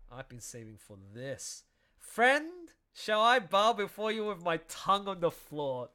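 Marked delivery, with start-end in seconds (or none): 2.0-5.9 louder, higher, over-the-top reading; mock bow to a friend; hammed-up grovelling. Reading comic dialogue aloud.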